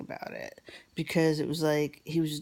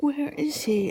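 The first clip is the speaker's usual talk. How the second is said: put-on high child asking anxiously; high, small worried voice; little girl voice; 11 semitones higher, 4 dB louder than usual. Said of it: "She's like".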